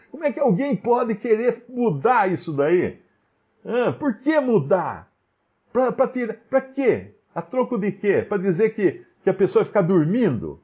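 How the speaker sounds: high-pitched, incredulous rhetorical questions; incredulous challenge. Real, not acted. Preacher challenges the opposing reading. (questionamento indignado, em tom agudo)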